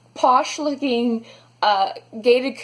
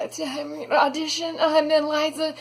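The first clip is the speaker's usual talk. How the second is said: slow, small, pitched-up voice; sheepish past self; 4 semitones higher, slower than usual. Retold or imitated. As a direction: mumble it like a lost auditioner. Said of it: "The guy is like".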